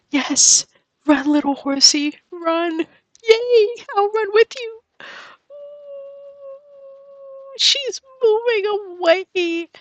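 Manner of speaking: very high, crying with joy; tearful cheering. Real, not acted. Gamer cheering her villager moving out, tearful squeals. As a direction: cheer her on through happy sobs.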